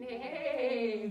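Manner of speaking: loud, drawn-out neigh; horse neighing. Pretend. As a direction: imitate a horse for the kids. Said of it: "What do they say".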